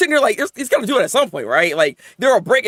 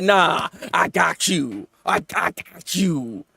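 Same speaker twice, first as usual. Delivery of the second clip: reassuring mother; 5 semitones lower, 3 dB quieter, much slower than usual. Retold or imitated. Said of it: "but she said".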